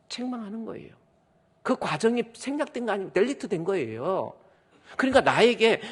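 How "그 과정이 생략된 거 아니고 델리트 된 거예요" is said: normal talking voice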